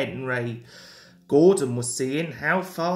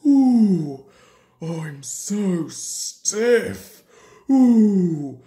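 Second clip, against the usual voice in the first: loud oohs, slow aching complaint; groaning; 5 dB louder, much slower than usual. A narrator's reading. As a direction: groan and stretch out each word stiffly. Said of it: "he groaned".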